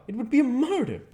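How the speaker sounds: shrill horrified plea; cried out. A narrator's reading. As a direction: stop the act in horror. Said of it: "he cried".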